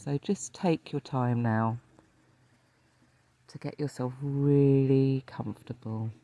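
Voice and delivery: calm meditation teacher, leaving space to breathe; guided meditation. Acted. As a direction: lead listeners gently into rest, phrase by phrase.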